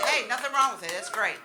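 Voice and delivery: ordinary delivery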